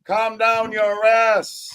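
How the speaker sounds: stretched-out singsong tease; slow teasing drawl. Acted. Host teases a chatter, slow and singsong. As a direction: drag every word out to needle him. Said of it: "teasing a chatter".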